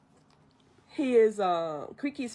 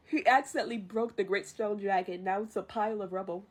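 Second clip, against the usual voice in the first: ordinary delivery, nothing marked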